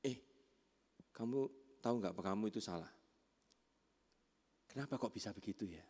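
soft, low, unhurried questioning; acted calm parental inquiry; gentle coaching. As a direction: leave pauses and ask softly, without blame. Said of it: "berbeda dengan coaching atau redeem".

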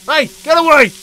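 high-pitched, exasperated outburst; frantic angry yelling. Real, not acted. Gamer shooing a fly off his meat, high angry yelling. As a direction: shriek at the pest, voice pushed right up.

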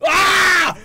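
sudden shrill high scream; jump-scared shriek. Real, not acted.

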